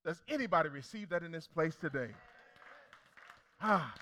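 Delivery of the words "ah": ordinary talk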